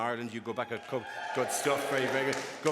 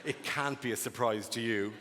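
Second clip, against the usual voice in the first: ordinary talk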